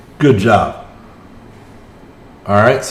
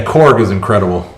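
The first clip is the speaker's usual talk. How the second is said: normal talking voice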